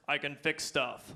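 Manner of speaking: low, slow mock macho voice; straight voice. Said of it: "the first straight phrase I learned is".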